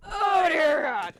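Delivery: high, loud drawn-out cry of dread; panicked exclamation. Retold or imitated. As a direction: cry out slowly in mock terror. Acts out dread on plane.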